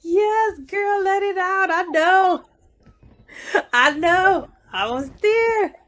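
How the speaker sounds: high-pitched, excited cheering-on; excited empathy. Real, not acted. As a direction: squeal it in solidarity with a tearful friend. Host cheers on her tearful guest.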